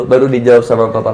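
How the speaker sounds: ordinary talk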